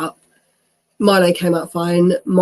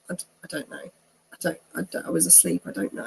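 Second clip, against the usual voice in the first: much softer, trailing, fumbling for words; mumbled unsure answer; 11 dB quieter, faster than usual. Retold or imitated. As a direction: mumble it, caught without an answer. Fumbling answer to brother's question.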